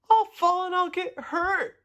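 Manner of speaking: high-pitched worried cry. A narrator's reading. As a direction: cry out high, afraid of falling. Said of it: "cried Tudley".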